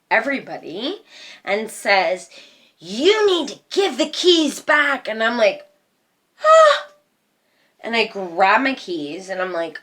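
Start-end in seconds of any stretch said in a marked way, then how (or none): 2.8-5.1 stern stepmother. Retold or imitated. Stepmother voiced demanding keys. 6.4-6.9 high, loud sudden yelp; a shocked high yelp at the stepmother's demand; startled yelp. Retold or imitated.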